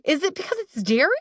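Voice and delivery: high, fast worried questioning; anxious fretting. Retold or imitated. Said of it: "I'm like".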